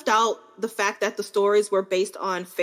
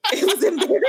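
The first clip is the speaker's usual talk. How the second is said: high, laughing confession; embarrassed laughing; 11 semitones higher, much faster than usual. Real, not acted. Podcaster confessing to sobbing over a book, laughing embarrassedly. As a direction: squeak it out through laughter.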